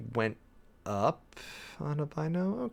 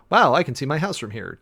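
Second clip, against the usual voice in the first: lightly acted wow, louder at the start; mock amazement; 3 semitones higher, 7 dB louder than usual. Hiker seeing their house from the mountain, lightly amazed. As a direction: exclaim like a hiker taking in the view. Acts out a hiker's clear view.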